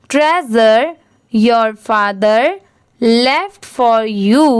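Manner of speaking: very slow, word by word for pupils to repeat; teacher reading a story line aloud for the class to repeat; slow read-along. Shown as a demonstration. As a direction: read it slowly so they can echo you. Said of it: "मेरे बाद आप भी repeat करिए".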